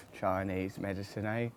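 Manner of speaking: normal talking voice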